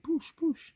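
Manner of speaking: high-pitched "poosh, poosh" punch noises; mimicked punch sounds. Mimics punches while boxing the cat.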